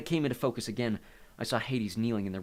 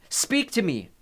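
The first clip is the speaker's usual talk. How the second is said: high, raised command to a spirit; commanding cry; 10 semitones higher, 7 dB louder, slower than usual. A narrator's reading. Nico orders his mother's ghost, crying out. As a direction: demand that the dead answer.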